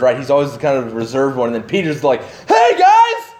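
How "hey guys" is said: loud, high excited call to his friends; bold Peter calling out. Retold or imitated. Peter hailing the disciples from the boat, excited shout. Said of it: "he's got the big presence".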